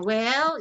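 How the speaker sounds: WELL dipping and rising; third tone. Shown as a demonstration. Teacher confirming for students, falling-rising WELL. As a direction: swing WELL down then up. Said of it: "that sound of the third tone".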